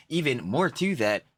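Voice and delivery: ordinary talk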